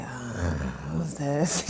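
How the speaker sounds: low, soft, groggy just-woken voice; deep baritone morning voice. Retold or imitated. Woken by a phone call, answering in a deep groggy morning voice. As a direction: croak it out half asleep, low and quiet. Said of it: "my deep baritone morning voice".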